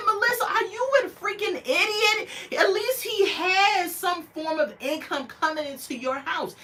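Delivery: high, incredulous rant; exasperated scolding. Retold or imitated. Vlogger scolding Melissa for mocking Louis's job, exasperated. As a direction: tell her off in disbelief.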